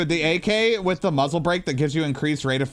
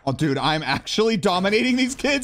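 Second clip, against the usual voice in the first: excited; 9 semitones higher than usual. Real, not acted. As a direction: stretch out the disbelief in hype. Celebrating his domination.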